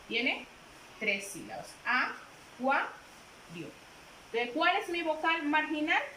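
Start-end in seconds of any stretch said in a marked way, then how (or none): 1.8-2.9 "Acuario" said slowly, split as a-cua-rio; slow syllable split. Shown as a demonstration. Teacher splitting "Acuario" for the class, slowly. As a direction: stretch "Acuario" into its three beats. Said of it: "¿Cuántas sílabas tiene?".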